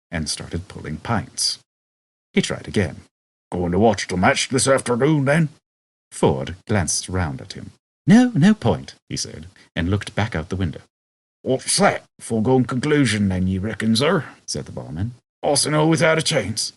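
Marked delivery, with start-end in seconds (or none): 3.5-5.5 put-on chatty old barman. Barman voiced. 8.1-8.9 high, loud dismissive brush-off; Ford's curt no. Acted. Ford brushing off the barman's chat, loud and curt. 11.4-14.3 put-on curious old barman. Barman voiced. 15.4-16.7 surprised barman. Acted. Barman voiced.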